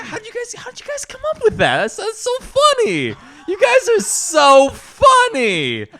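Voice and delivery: high, loud, exaggerated fake delight; mock gushing admiration. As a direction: gush sarcastically, stretching it out with laughter. Mock admiration confronting the liar.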